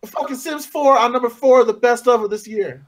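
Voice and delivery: high-pitched ranting disbelief; squeaky indignation; outraged complaint. Real, not acted. Outraged at the ranking.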